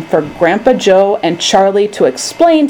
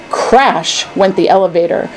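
plain reading voice

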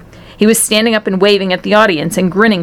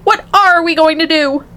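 high-pitched mother's lament; acted high wail; 10 semitones higher than usual. A narrator's reading. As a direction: wail it in dismay, pitch raised. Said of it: "wailed Missus T V".

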